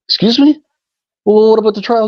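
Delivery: high, loud, astonished questions; can't believe what he just heard; stunned disbelief. Retold or imitated. Defendant told he's free, loud high astonishment.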